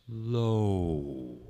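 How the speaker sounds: "low" sliding downward in pitch; sliding diphthong. Shown as a demonstration. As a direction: let "low" fall as it glides. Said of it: "slides into a lower register".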